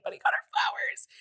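whispered gossip aside. Real, not acted. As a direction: lean in and whisper it.

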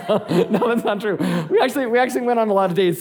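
fast, laughing correction; giggly self-correction. Real, not acted. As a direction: rush it out while laughing.

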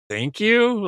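high, mock-sweet thank you; sarcastic. [name] sarcastically thanking Cooper, high mock tone.

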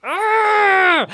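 high, drawn-out aah; high cry. Acted. Player acting out taking over Kyle, high drawn-out cry. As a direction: hold a high, sustained cry. Player voices psychic takeover.

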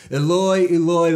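high, loud anguished cry; Jesus crying out from the cross, loud and high. Acted. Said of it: "Jesus cried out in a loud voice".

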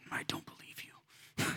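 whispered, slow and suspicious; inner voice whisper. Retold or imitated. Pastor voicing a listener's inner doubt, whispered slowly. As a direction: breathe it like a doubting thought in your head. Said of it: "that voice in your head that goes".

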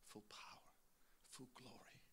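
breathy whisper of worship; whispered praise. Real, not acted. Pastor in prayer, whispering full power and glory. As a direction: whisper it to God. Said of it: "whispered prayer".

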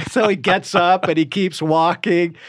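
ordinary talk

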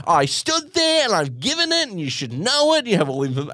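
high, pompous lecture; lecturing customer. Retold or imitated. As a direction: lecture the staff like a self-righteous customer. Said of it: "he starts the lecture".

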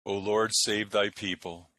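solemn prayer voice; liturgical prayer. Acted. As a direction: lead the prayers in church. Intones versicles and collects.

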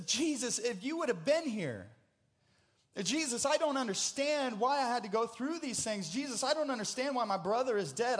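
preacher voicing a bereaved sister's protest; anguished pleading. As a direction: pour out the grief in mounting, repeated pleas. Voicing Martha's anguished complaint to Jesus.

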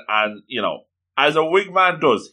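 ordinary talk, nothing marked

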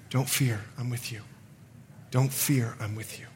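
hushed, fading reassurance, near whisper at the end; soft voice of God. Retold or imitated. God ministering amid the yelling, soft reassurance. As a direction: soothe quietly, letting each phrase fall away. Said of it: "God just kept ministering".

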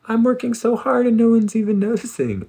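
high-pitched anxious complaining; frantic whiny brain. Retold or imitated. The anxious mind griping about everyone, high and whiny. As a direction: squeak out the petty grievances. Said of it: "our brain is like".

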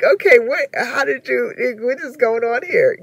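loud, high, jealous questioning. Retold or imitated.

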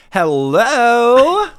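very high, loud, drawn-out hello; husband mimicking his wife's work-call hello; trainer greeting impression. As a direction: sing the hello out like an overeager trainer. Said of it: "I'm gonna do my best impression".

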